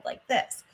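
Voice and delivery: gentle, steady s hiss; soft controlled hiss. Shown as a demonstration. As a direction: let the s out softly and evenly. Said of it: "much softer and more controlled".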